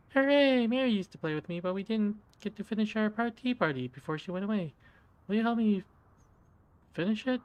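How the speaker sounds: put-on high, sweet little girl doll voice; a doll's squeaky childlike voice; creepy talking doll. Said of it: "the mouth begins to move".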